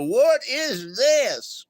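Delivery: disgusted. Retold or imitated. Disgusted at jail food.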